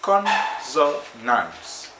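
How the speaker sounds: "consonants" broken slowly into syllables; syllable-by-syllable model. Shown as a demonstration. Teacher modelling "consonants" for learners to say, slow and broken up. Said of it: "Say it".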